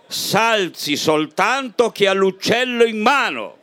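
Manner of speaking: lively, theatrical poem reading; poem recital. Acted. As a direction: recite it to the room like a comic ballad. Recita una poesia di Trilussa. (recita teatrale di una poesia)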